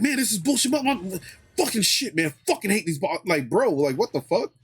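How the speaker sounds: high-pitched mock rant, far from his own voice; imitating cousin's cursing rant. Cousin losing it at work, retold as a high mock rant. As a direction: vent it the way the cousin did on the phone. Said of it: "cussing up a, a storm".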